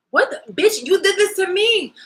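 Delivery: high and loud outburst; outraged. Real, not acted. Accusing the betrayer, a high loud outburst. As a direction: explode at the one who did this.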